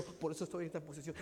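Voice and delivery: normal talking voice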